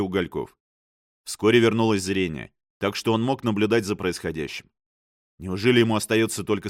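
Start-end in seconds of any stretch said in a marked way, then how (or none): none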